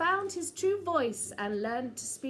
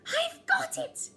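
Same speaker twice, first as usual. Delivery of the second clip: high eager squeak of an idea; bursting with the idea; high excited little mouse. Acted. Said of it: "he said".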